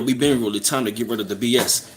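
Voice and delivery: ordinary talk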